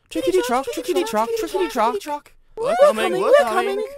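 very high, loud chugging chorus; panting coaches. A narrator's reading. Coaches answering the engine behind them, high loud panted chant. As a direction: chug it in a squeaky-high rhythm. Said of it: "they panted back".

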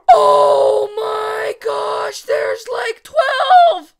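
drawn-out high-pitched shriek of amazement; very loud, high, thrilled yelling; ecstatic shout. Real, not acted. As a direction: shout it slowly, overwhelmed with excitement. Counting the ocean dogs, amazed.

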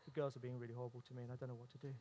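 put-on soft, low and fast little-boy voice; cute quiet mode. Son telling dad about his sisters, soft and quick. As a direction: mumble it small and quick like a sad boy. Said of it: "He goes into this cute, quiet mode".

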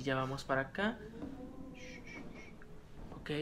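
ordinary talk, nothing marked

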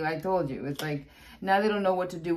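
ordinary delivery

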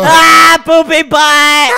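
loud, high drawn-out "poopy butt"; hammed-up cry of the nickname; mock wail. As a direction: wail it out for laughs.

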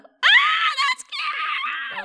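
very high squeal of cuteness; squealing delight. Real, not acted. Reacting to a cute game moment, high squeal. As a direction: squeal like it's adorable. Gushing over game character.

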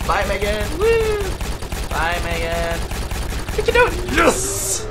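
high, loud gleeful farewell; gleeful shouting. Real, not acted. Sending Megan flying, gleeful loud shouting. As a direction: shout the goodbye with glee. Launching a teammate away.

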